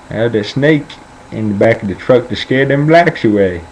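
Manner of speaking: put-on old Southern grandpa voice. Grandson mimics grandpa answering him, old Southern voice. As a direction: imitate a Southern old-timer telling his tale. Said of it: "but this is how he talks".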